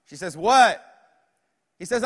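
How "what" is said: loud, raised "What?"; exasperated mom. Acted. Mom answers her boy's bedtime call, exasperated and loud. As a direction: answer like a fed-up mother.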